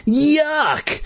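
very high disgusted squeak; squeaky bee voice. Acted. Boy turned into bee.

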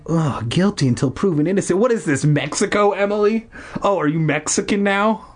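high-pitched, exasperated mockery; sarcastic retort. Narrator mocking the sister mid-quarrel, sarcastic.

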